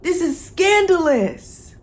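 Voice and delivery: slow, high, loud mock shock; mock scandalized. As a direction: gasp it, scandalized. Playing Penelope, mock shocked.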